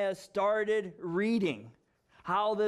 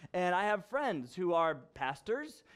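ordinary talk

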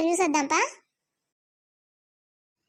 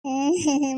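sob; 2 semitones lower than usual. Acted. Daughter sobbing over father.

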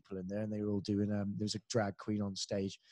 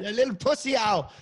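mock taunt; 10 semitones higher, 7 dB louder than usual. Real, not acted. Teasing his co-host.

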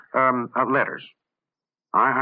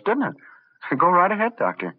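normal talking voice

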